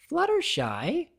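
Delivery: high, slow disbelieving question; can't believe it's her; incredulous. A narrator's reading. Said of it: "she said incredulously".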